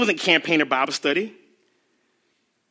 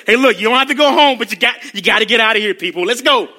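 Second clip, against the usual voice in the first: louder, fast herding call; Peter hustling people; 6 dB louder than usual. Retold or imitated. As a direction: hurry the crowd out like an impatient usher. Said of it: "Peter pulled us up".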